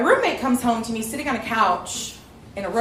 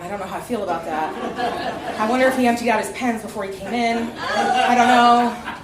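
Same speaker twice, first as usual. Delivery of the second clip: ordinary talk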